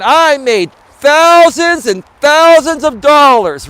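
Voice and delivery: loud, high, drawn-out THOUSANDS; slow infomercial pitch punching each key word; Don Lapre emphasis. Retold or imitated. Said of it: "talking slow and emphasizing those words".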